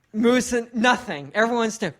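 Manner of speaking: normal talking voice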